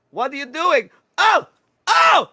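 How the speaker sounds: high, loud horrified squeal; freaking out. Acted. Pickle Juice catches Zoroff with dead mice, freaking out. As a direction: shriek it in a high, panicked character voice. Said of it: "she starts freaking out".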